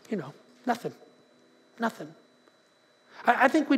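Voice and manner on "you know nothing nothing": low, much softer "nothing"; hushed answer. Real, not acted. Preacher answering what goalless friendships get you, hushed. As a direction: drop the punchline down low and quiet. Quietly answering own rhetorical question.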